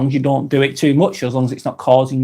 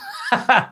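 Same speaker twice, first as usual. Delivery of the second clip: short burst of higher laughter; real laugh at a viewer's comment; sudden laugh; 9 semitones higher, 3 dB quieter than usual. Real, not acted. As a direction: let a quick, high laugh slip out. Laughs after reading a comment.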